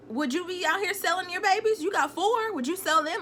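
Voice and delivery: imitating an affronted mother; incredulous challenge. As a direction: throw it back as an accusation. Retells mother's challenge to woman.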